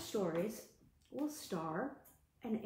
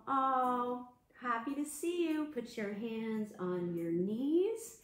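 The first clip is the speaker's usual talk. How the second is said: normal talking voice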